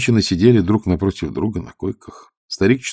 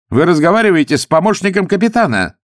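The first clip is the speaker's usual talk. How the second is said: loud, higher self-important tone; pompous; 11 semitones higher, 6 dB louder than usual. A narrator's reading. Young officer pompously introducing himself to the old man. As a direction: puff yourself up. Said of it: "напыщенно произнёс юноша".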